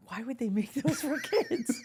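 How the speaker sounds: high, drawn-out, laughing question; incredulous, voice climbing through a laugh; amused disbelief. Real, not acted. Baffled by candy cigarettes for kids.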